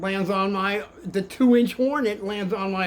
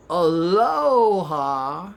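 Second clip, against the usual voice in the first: drawn-out aloha; 5 dB louder, much slower than usual. Retold or imitated. Greeting the wasps at the end.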